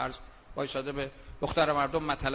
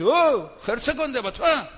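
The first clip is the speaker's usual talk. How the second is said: higher, louder thug's threat; higher, louder mock brawler threat; mock tough threat; 7 semitones higher, 9 dB louder than usual. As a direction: bark it like a street tough. Acts street tough threat.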